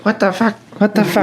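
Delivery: high squeaky talking bird; macaw impression. Owner imitates his pet macaw swearing, squawky. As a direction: mimic a parrot repeating a learned phrase. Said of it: "she'd be like".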